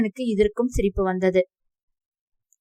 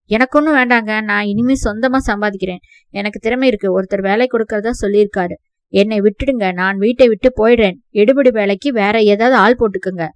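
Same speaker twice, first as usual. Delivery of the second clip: loud, defiant declaration; angry defiance; 8 dB louder than usual. Acted. Nephew tells guardians he is leaving, loud and defiant. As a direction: throw it at them, done with them.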